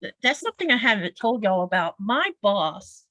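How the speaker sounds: ordinary talk, nothing marked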